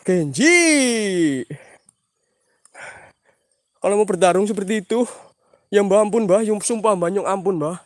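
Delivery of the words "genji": lightly voiced long falling call, louder; a hint of a monster yell; Genji's cry. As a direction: drag the name out like a war cry. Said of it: "teriakannya Genji".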